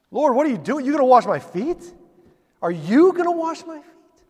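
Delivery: high, loud, incredulous pleading; rising alarm, pitch climbing to a cry; struggling Peter. Retold or imitated. Peter protesting as Jesus kneels, high and loud. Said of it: "Peter's struggling with this".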